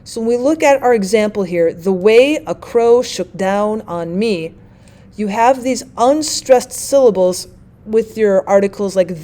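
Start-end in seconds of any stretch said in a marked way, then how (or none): 1.7-4.6 slow iambic reading, leaning on "way", "crow", "down" and "me"; iambic stress. Shown as a demonstration. Teacher scanning an example line for students, iambic stress. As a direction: read slowly with "The", "a", "shook", "on" light and every other word stressed. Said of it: "an unstressed syllable followed by".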